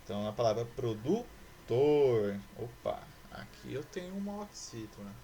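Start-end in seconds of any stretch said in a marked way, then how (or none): 0.6-2.4 "produtor" broken before a loud, long TOR; stress on final syllable. Shown as a demonstration. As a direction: hit and stretch the last syllable of "produtor". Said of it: "a última sílaba é a sílaba tônica". ("produtor" com a última sílaba, TOR, forte e alongada)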